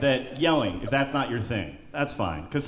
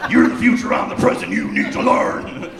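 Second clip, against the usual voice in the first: mock tough guy; 8 semitones higher, 4 dB louder than usual. Threatening teens jokingly.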